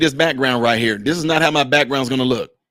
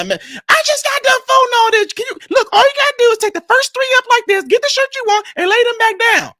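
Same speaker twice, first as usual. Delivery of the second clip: a much higher cartoonish wife scolding her husband; wife imitation. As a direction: squeal it like an exaggerated fed-up wife. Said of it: "I know she don't sound like that".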